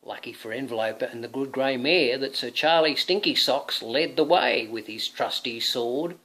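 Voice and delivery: ordinary delivery, nothing marked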